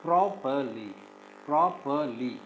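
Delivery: "properly" broken into three separate syllables; measured "properly", each syllable set apart. Shown as a demonstration. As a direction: say "properly" as pro, per, ly with gaps. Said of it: "we divide this into three syllables".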